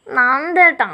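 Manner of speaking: put-on whining his name like a sulky child; whiny call. She whines Nandettan's name after his teasing. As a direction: drag his name out in a pout. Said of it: "അവൾ ചിണുങ്ങിക്കൊണ്ട് വിളിച്ചതും".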